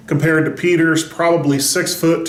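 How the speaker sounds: usual speaking voice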